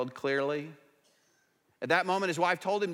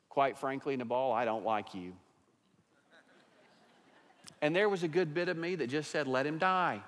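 quiet, tender confession; calm wife; 4 semitones lower, 4 dB quieter than usual. Retold or imitated. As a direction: soften it, low and gentle. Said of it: "probably in a very calm manner".

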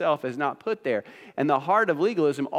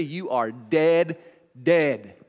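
preacher hammers home God's warning: emphatic, slow, repeated dead; 3 semitones lower, much slower than usual. Shown as a demonstration. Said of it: "It's a very emphatic statement".

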